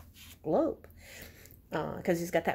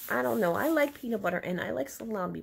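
ordinary delivery, nothing marked

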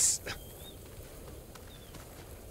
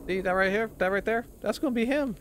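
gleeful, rising higher and higher; getting more and more worked up; excited high voice; 8 semitones higher than usual. Real, not acted. Predicting an NPC's death.

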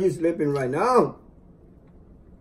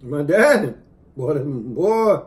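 proud; 7 dB louder than usual. Real, not acted. Proud remembrance of father.

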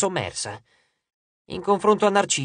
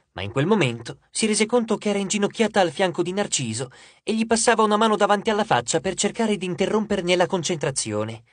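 ordinary delivery

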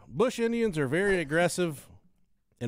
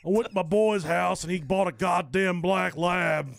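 put-on southern accent; 4 semitones higher, 5 dB louder than usual. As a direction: rant like a Southern bigot, a bit louder. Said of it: "when you use a southern accent".

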